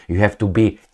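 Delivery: ordinary talk, nothing marked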